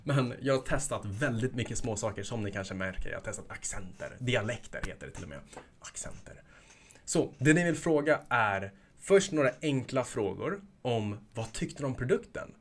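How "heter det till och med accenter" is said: sneaky whispered correction; whispered under the breath. Real, not acted. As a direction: whisper it as a stubborn aside. Whispered self-correction joke.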